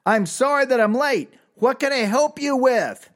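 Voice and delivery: mock yell at a high pitch; imitated yelling. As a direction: call it out high, as if to a deaf customer. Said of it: "he starts yelling".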